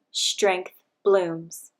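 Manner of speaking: usual speaking voice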